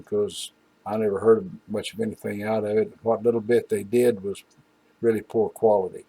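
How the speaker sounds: ordinary talk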